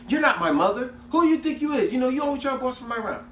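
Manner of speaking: sassy little sister talking back; mock sister argument. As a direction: snap back at the older sister, fast. Sisters arguing in a retold quarrel.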